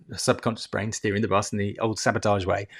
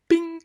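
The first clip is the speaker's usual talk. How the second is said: lift bell bing. Pretend.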